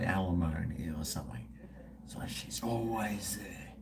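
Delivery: unvoiced hushed aside; low whispered aside. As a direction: whisper it like a grim joke. Whispered aside about nagging ex.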